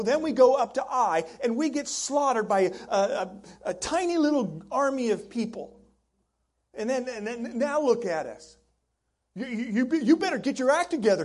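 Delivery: loud, indignant complaint; complaining to God. Retold or imitated. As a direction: scold God like a frustrated friend. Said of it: "those weren't the exact words of Joshua".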